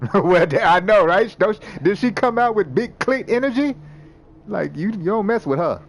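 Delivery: the game master cracks up at the character's line; laughing delight. Real, not acted. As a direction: gush through the laughter. GM laughs about the scene.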